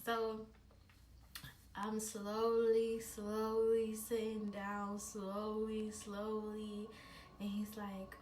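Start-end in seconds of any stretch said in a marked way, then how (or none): 1.8-6.9 drawn-out slowness. Retold or imitated. Storyteller reliving a cautious move, slow and drawn out. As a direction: stretch each word to mirror a careful movement.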